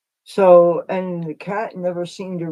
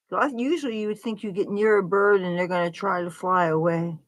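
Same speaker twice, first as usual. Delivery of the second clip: ordinary talk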